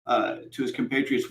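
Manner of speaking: ordinary delivery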